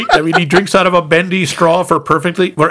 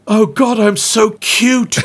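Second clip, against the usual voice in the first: admiring voice; 5 semitones higher, slower than usual. Retold or imitated. [name] admiring young self.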